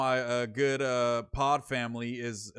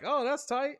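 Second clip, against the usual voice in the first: impressed; 11 semitones higher than usual. Retold or imitated. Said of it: "I was like".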